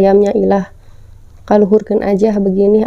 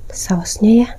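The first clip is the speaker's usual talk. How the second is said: whispering; slower than usual. Real, not acted. Showing the sauce on camera.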